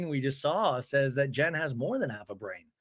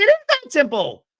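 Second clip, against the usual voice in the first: high, loud mock shout; yelled retort; 12 semitones higher, 10 dB louder than usual. [name] answers Jen's doubt, high exasperated yell. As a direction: shout it back, imitating an exasperated housewife.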